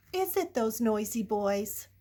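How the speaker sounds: ordinary delivery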